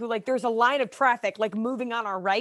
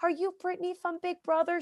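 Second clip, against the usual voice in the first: put-on little girl; 7 semitones higher than usual. Six-year-old fan asks her.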